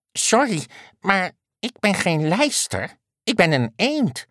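high, timid duck voice; apologetic duck. A narrator's reading. Duck correcting the unicorn's name for it, timid. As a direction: correct gently in a small high voice.

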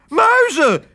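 hoge, luide angstkreet; schreeuw. Acted. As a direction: schreeuw het uit van afschuw. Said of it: "schreeuwde tante Lena". (tante Lena ziet muizen: hoge kreet)

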